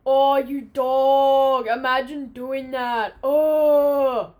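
loud, drawn-out crowd jeer; hammed-up mocking holler; jeering shout. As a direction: heckle like a whole group of boys.

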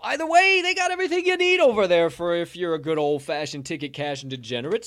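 hyped ad read. Acted. Host reading sponsor ad.

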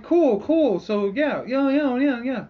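high, loud chirpy cool; breezy Martian clerk. Retold or imitated. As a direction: wave it through cheerfully. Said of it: "they're like".

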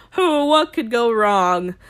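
slow, loud mock wondering; sarcastic. Real, not acted. Host joking about insecure men playing rugby, sarcastic. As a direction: ask it with heavy, drawn-out irony.

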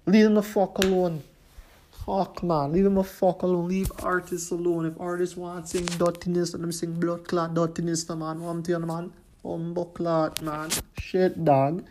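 worked-up street rant; heated rant. Real, not acted. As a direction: vent hard at the critics. Opening rant about leaving artists alone.

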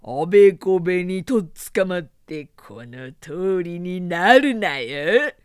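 loud, slow taunting; mocking. A narrator's reading. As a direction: taunt the soldiers with a loud sneer. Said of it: "揶揄した".

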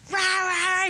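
high, roaring rah-rah; hammed-up scary-parent holler; mock screaming parent. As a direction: imitate a parent yelling in wordless bursts. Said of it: "screaming and yelling and scaring their child".

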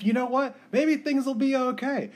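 high-pitched, naive hopeful imitation; mock optimism. Co-host mocking trusting movie characters, high mock optimism.

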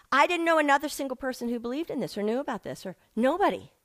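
ordinary talk

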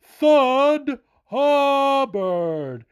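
slow, stretched-out high announcer call, each name drawn long; ring announcer imitation. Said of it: "Buffer did not even make it sound that great".

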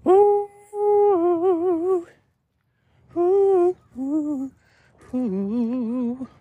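squealing ooh sounds far up in the head voice; high-pitched cooing oohs to a dog. Real, not acted.

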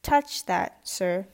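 slow, loud sharp warning; sharply. Acted. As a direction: stop his hand with your voice. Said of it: "Phillips said sharply".

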